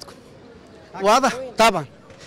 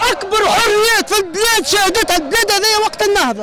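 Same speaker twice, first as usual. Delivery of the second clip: heated raised voice; 7 semitones higher than usual. Real, not acted. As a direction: push your pitch up and hammer the point. Heated political argument, raising voice. (صوت مرتفع ومنفعل)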